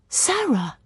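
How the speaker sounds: high, astonished cry of a name; startled recognition, pitch leaping up; surprised call. A narrator's reading. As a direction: call out, taken completely by surprise. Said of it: "Ben calls out in surprise".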